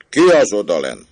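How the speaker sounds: loud, terrible bellow; booming angry roar. Acted. Bully at the window challenges the man below, roaring angrily. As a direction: roar down in fury at the intruder. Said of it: "öblös, rettenetes és dühös hangon elordította magát".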